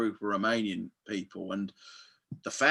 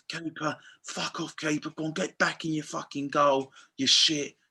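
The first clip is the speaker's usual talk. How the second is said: retold coach abuse; 5 semitones higher than usual. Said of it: "They said, like".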